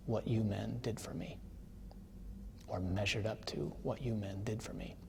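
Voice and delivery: soft, halting words with long pauses; hushed graveside address. Retold or imitated. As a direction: quietly voice a veteran speaking to his fallen rescuer. Old veteran speaking at a grave.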